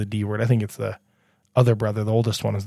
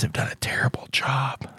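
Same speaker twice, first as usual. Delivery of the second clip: whispering; 10 dB quieter than usual. Real, not acted. Teasing players about ignoring NPCs.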